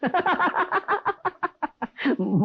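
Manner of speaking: high, long laugh of disbelief; scoffing laugh at the rain confession; disbelieving laugh. Acted. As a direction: laugh it off as a joke.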